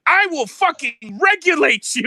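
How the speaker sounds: hammed-up fury. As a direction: rant like an enraged wizard. Acted wizard threatening Bilbo.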